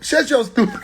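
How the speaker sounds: laughing retort. Retold or imitated. Breaks off laughing.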